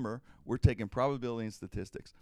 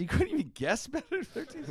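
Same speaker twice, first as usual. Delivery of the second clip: higher, laughing through the question; laughing disbelief; 3 dB louder than usual. Retold or imitated. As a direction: laugh in disbelief as you ask. Incredulous at friend's score.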